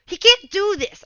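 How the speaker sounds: loud, sharp "He can't do this" with a stress on "can't"; loud, punchy imitation of a pro player's complaint; RTZ's outburst. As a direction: blurt "He can't do this" loudly, as if stunned by a bad play. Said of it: "when you hear him be like".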